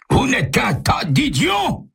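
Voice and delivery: high-pitched, drawn-out angry shout; furious yell. A narrator's reading. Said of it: "hurle Pessac".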